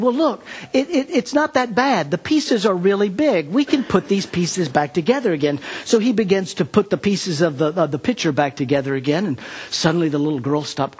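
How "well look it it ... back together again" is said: much higher, bright coaxing reassurance; reassuring. Retold or imitated. Pastor coaxing the girl that the pieces fit, high and reassuring. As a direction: cheer up a crying child.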